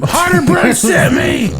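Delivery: loud, high shouted password; scream it. Pretend. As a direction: yell it out at the counter.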